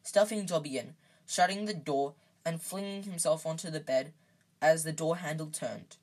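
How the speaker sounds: ordinary delivery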